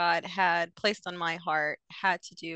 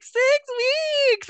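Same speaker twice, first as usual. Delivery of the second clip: retold crying; 9 dB louder than usual. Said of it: "and, like, crying".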